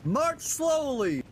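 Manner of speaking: hammed-up slow officer's order; mock drill command. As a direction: stretch it out like a pretend marching order.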